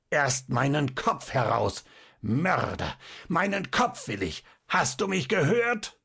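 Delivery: high, crazed demanding voice; raving demonic fury; wild demonic scream. A narrator's reading. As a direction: screech the demand like one possessed. Madman keeps screaming while strangling doctor.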